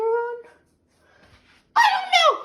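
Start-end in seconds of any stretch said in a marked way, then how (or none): 1.8-2.3 yelling. Real, not acted. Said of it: "I'm sorry for yelling at you".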